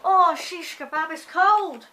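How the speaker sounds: loud, high yelp, stretched slow; shocked by the cold. Real, not acted. Hit by the freezing air outside, high loud shriek. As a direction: shriek it as the cold bites you. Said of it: "That's my new saying".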